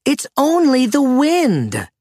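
high character voice calling out; shouting. A narrator's reading. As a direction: call it out high, falling at the end. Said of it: "a voice shouted".

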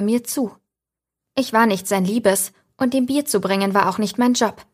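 usual speaking voice

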